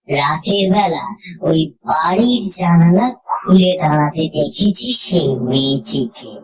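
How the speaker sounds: ordinary delivery, nothing marked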